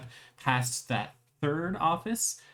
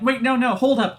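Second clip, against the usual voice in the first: high, loud alarmed call; cashiers shouting; 10 semitones higher, 8 dB louder than usual. Acted. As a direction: yell to stop them in their tracks. Cashiers stopping the players.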